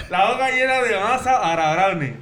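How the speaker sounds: blurred p, b and m; garbled mouth-game speech. Real, not acted. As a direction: repeat it carefully with lips held open. Mouth game, partner guesses distorted phrase.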